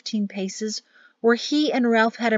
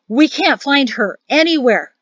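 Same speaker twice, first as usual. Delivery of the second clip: urgent, raised-voice father; a touch breathless, higher and louder; panting; 5 semitones higher, 8 dB louder than usual. A narrator's reading. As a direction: blurt it out in alarm. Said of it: "her father panted".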